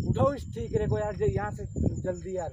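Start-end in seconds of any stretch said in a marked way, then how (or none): 0.1-1.0 high-pitched, hurried urging; urgent high call. Real, not acted. Friend urging the others to lift the pot, urgent high call. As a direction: push them to grab it fast.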